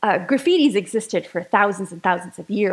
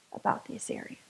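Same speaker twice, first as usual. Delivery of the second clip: whisper; 13 dB quieter, faster than usual. Real, not acted. Rhetorical question whispered.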